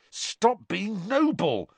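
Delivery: a touch of exasperation; irritable. A narrator's reading. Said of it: "said Harry irritably".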